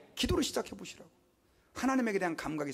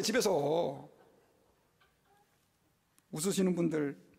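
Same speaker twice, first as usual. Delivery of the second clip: normal talking voice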